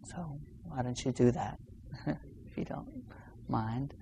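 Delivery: soft, half-whispered with a laugh; quiet aside. Real, not acted. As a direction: drop to a hushed, amused request. Playful aside inviting listeners to try.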